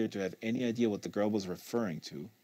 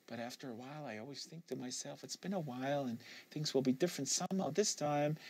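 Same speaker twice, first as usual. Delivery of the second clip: much softer, inward thought; Kate, hushed; 4 semitones higher, 8 dB quieter than usual. Acted. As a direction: say it quietly to herself.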